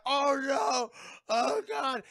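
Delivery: high, loud cry of horror; hammed-up anguished outcry; horrified wail. Acting horror at the crash.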